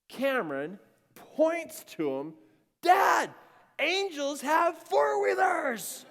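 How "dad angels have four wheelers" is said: high, loud, stretched-out shout of wonder; amazed kid shouting. Retold or imitated. Son spots riders coming over the hill, amazed shout. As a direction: imitate an awestruck boy yelling to Dad. Boy amazed at four-wheelers.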